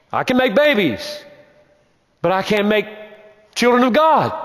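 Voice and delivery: impassioned preaching. Acted. Preacher urging his church about the flesh, rising fervor. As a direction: drive the point home to the pews.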